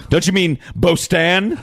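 BOSTON said slowly as "bo-STAN"; slow mock correction, Boston with a long "o" and full "an"; deliberate mispronunciation. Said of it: "We should do that, like, with our cities".

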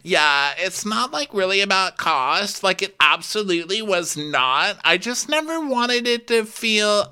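high, strained mock-sincere voice; Victoria. Denying it was about cost, high mock sincerity. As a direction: explain in an exaggerated high register. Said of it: "her voice drops two registers".

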